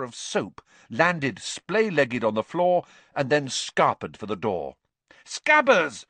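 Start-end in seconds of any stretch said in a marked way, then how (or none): 5.2-6.1 high, loud call; shouted. A narrator's reading. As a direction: shout after a runaway pet. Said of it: "Ron shouted".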